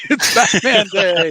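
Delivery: announced through laughter; high, laughing shout. Real, not acted. Laughing Batman Day announcement.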